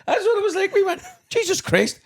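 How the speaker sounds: high, laughing disbelief. Real, not acted. Telling his friend about the spooky hotel, laughing high. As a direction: crack up as you say it, way up.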